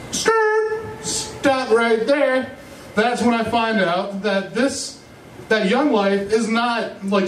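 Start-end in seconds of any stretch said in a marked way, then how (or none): 0.1-2.6 high squealing skid noise, then slow halt; mock tyre screech, then a slow stop; screeching brakes. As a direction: slam the brakes with your voice. Mock brake, halting the story.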